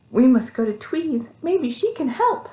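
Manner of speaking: high little mouse voice; lightly voiced small mouse; hopeful mouse. A narrator's reading. Mice voice.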